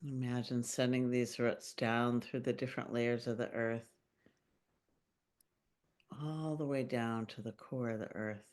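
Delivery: calm meditation voice with long pauses; unhurried, gently guiding listeners into their bodies; guided meditation. Acted. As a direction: lead a grounding visualization, phrase by phrase, leaving long silences. Leads a grounding meditation.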